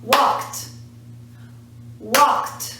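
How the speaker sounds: "walked" said as "walkt", crisp final "t"; one syllable. Shown as a demonstration. Teacher showing past is also one syllable, "walked" as "walkt". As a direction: keep "walked" to one beat ending in "t". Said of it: "one syllable".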